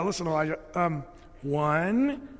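sarcastic counting. Pretend. Servant sarcastically counting enemies to the prophet, laughing. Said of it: "if I were that sarcastic".

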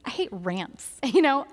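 normal talking voice

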